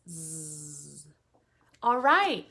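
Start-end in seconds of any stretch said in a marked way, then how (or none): 0.1-1.1 "Z" as a long, soft buzz, no vowel; letter-sound drill. Shown as a demonstration. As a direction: buzz "Z" out steadily and quietly. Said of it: "And Z D Zebra says".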